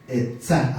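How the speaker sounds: etzá con el acento en la última A. Shown as a demonstration. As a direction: decir etzá cargando la A final. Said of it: "acento en la A". (etzá, pronunciación hebrea con acento final)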